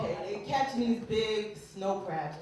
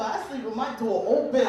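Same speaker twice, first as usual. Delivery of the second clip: usual speaking voice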